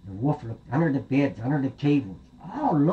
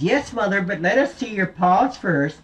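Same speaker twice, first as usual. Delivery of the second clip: little goats; 8 semitones higher, 4 dB louder than usual. Acted. Little goats answer the knock.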